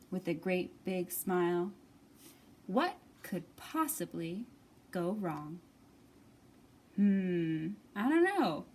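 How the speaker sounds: ordinary delivery, nothing marked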